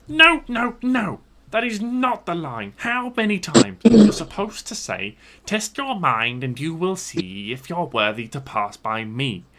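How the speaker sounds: high, loud, furious goblin screech; raging goblin. Acted. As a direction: squeal like a livid little goblin. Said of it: "jumping up and down in rage".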